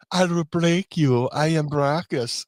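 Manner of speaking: slow German strongman voice; big slow muscleman accent; barbarian. Retold or imitated. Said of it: "he'd be like".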